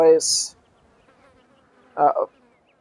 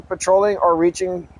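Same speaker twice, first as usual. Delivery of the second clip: ordinary talk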